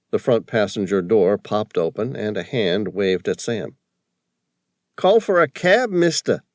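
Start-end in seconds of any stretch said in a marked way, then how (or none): 5.0-6.4 high, loud broad East Coast cabbie voice. A narrator's reading. Cabbie calling to Sam from the taxi, broad East Coast accent. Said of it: "with a broad East Coast accent".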